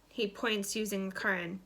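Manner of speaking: plain reading voice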